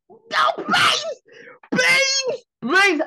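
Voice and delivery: very high, loud squealed begging; hammed-up frantic pleas, almost crying; mock pleading. Comedian re-enacting meeting her idol, high hammed-up pleading.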